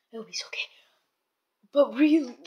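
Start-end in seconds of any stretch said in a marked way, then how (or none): 0.1-0.7 worried whisper. Real, not acted. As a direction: whisper it with worry. Whispering worried about cat.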